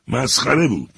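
sudden high, loud outburst; spring up and yell it; shouted. A narrator's reading. Fred jumping up, shouting at the narrator. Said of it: "فریاد کشید".

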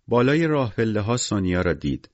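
usual speaking voice

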